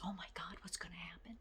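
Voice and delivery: hushed, alarmed whisper; worried whisper. Retold or imitated. A coworker whispers her alarm to a colleague. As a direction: whisper it like you fear trouble. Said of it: "said to one of my employees".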